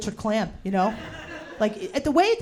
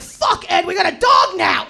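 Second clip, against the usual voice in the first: mock shout, higher and louder; panicked principal; 5 semitones higher, 5 dB louder than usual. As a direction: yell it like a startled principal. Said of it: "the principal was like".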